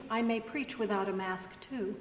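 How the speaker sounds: ordinary talk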